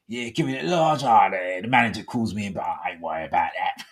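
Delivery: lightly put-on Cockney tough guy; Cockney big-man. Host mimicking a workmate boasting before meeting, mock Cockney swagger. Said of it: "like, Cockney kind of thing".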